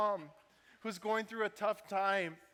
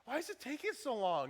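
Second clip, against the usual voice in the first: high-pitched exasperated mock complaint; annoyed; 10 semitones higher, 4 dB louder than usual. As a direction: play up the outrage, voice shooting way up. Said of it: "You're just getting annoyed".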